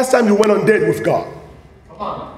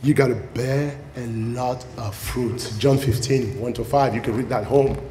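soft closing; 7 semitones lower, 6 dB quieter than usual. Real, not acted. Closing softly.

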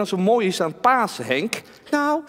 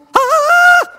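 high shrill puppet scream; doll scream; 10 dB louder than usual. Acted. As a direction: shriek in fright. Doll sees monster in mirror.

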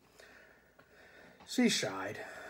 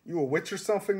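higher-pitched monster moan; 5 semitones higher, 3 dB louder, faster than usual. Acted. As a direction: complain in a mild moan. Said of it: "moaned the boogeyman".

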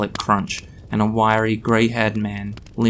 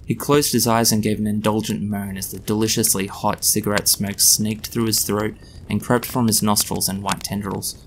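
ordinary delivery

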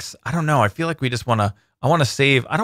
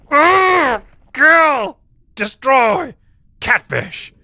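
battle shout; 9 semitones higher, 4 dB louder, much slower than usual. Acted. Attacking as catfish.

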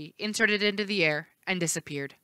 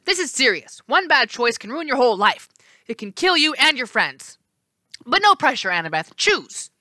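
stern doorman; 6 semitones higher, 4 dB louder than usual. A narrator's reading. Left face warns.

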